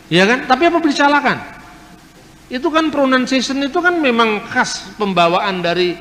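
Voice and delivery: normal talking voice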